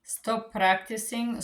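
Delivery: ordinary talk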